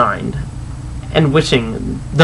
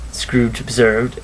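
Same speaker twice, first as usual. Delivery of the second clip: ordinary delivery, nothing marked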